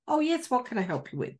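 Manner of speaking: high, fast Irish Siri voice; chirpy Irish voice assistant; Irish accent. Retold or imitated. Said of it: "an Irishman".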